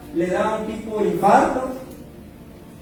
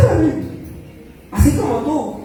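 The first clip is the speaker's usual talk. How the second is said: amazed; 9 semitones higher, slower than usual. Retold or imitated. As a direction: sound stunned and delighted. Teller's amazed reply. (asombro agudo y más fuerte)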